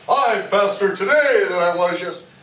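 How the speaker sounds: loud boastful announcement; mock bragging. Instructor mocking a student bragging loudly. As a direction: brag it at people, mockingly. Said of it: "walk up to people in school and go".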